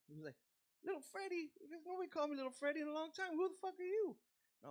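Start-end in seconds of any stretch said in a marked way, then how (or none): 0.9-4.2 high, incredulous imitation of a challenge; indignant disbelief. Singer to a fan using his old name, indignant. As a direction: demand who this stranger is, pitched way up.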